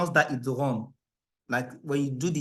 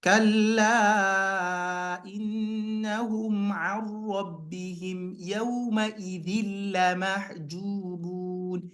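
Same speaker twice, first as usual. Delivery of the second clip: chanted, higher, emphasizing idgham; recap recitation; 6 semitones higher, faster than usual. Shown as a demonstration. Teacher's recap reading stressing idgham places.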